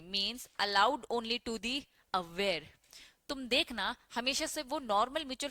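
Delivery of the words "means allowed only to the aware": slow dictation. Shown as a demonstration. Teacher dictating notes to students.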